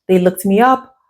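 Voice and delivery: ordinary talk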